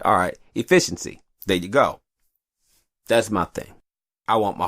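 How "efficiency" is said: "Efficiency" said cleanly and firmly; clean retry. Shown as a demonstration. Finally getting the word out, firm clean retry. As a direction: nail "Efficiency" at last. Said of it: "We gonna pretend I did".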